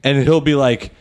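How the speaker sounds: normal talking voice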